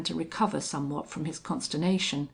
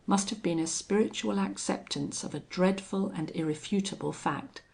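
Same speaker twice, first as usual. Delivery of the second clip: ordinary delivery, nothing marked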